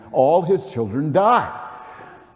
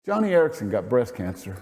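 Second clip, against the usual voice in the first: ordinary talk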